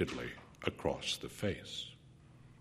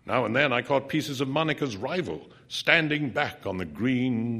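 plain reading voice

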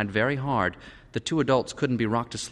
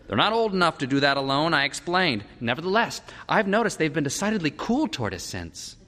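higher, animated dialogue voice; concerned parent; 9 semitones higher than usual. A narrator's reading. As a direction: state the grievance in a lifted voice. Parents discuss children.

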